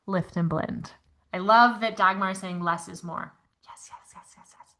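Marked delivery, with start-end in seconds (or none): 3.6-4.8 whispered yeses. Real, not acted. Agreeing softly with chat comment.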